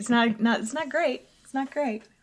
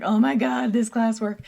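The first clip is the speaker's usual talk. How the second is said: exasperated complaint; 3 semitones higher, 5 dB louder than usual. Retold or imitated. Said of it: "I'm always like".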